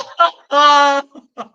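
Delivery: loud high burst of laughter. Real, not acted. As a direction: shriek with laughter. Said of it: "C'est mon rire".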